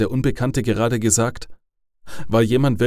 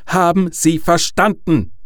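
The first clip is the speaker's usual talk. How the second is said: high, loud, menacing demand; threatening; 7 semitones higher, 5 dB louder than usual. A narrator's reading. Caller demands an answer from Harold, threatening. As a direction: raise pitch and volume, pressing each word. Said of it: "Dieses Mal klang seine Stimme bedrohlicher".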